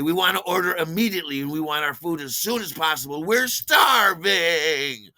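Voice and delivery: rushed, loud demand building to a stretched-out holler; demanding diners. Retold or imitated. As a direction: whine loudly, dragging out the last word. Drunk diners demand food, retold.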